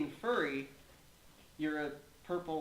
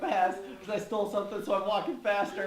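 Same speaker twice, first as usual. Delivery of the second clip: laughing; 6 semitones higher, 6 dB louder, faster than usual. Real, not acted. Joking, laughing.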